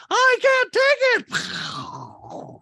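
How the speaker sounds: very slow, high, loud mock swoon; high loud drawn-out gushing caricature; Dorit overwhelmed. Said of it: "Dorit, her head explodes".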